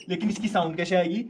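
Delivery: ordinary talk, nothing marked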